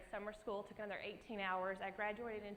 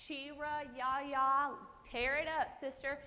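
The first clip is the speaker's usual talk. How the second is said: a hint of Nana cheering, higher and louder; hype grandma; 5 semitones higher, 6 dB louder, much slower than usual. Nana encouraging her granddaughter, lightly voiced cheer. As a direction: pump her up like a proud grandma.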